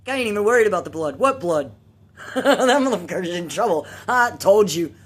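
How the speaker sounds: fast, loud, laughing gloat; gleeful. Retold or imitated. Girl gloating that brother got caught, loud laughing glee. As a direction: crow with delight at his trouble. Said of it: "sitting here laughing at my brother".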